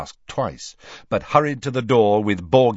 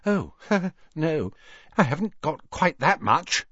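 high voice with a little nervous laugh; awkwardly cheery; 8 semitones higher than usual. A narrator's reading. Hermione declining the costly necklace, awkwardly cheery.

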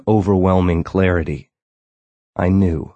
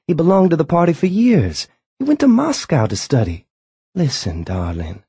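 higher, urgent wife voice; wife's voice; 9 semitones higher than usual. A narrator's reading. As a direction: plead as the worried wife. Wife pleads Earl's case.

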